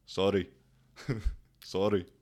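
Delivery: put-on "sorry, sorry" said quickly in a light local accent with a laugh; mimicking locals. Imitating how Czech locals apologise, mildly mocking. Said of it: "they just say".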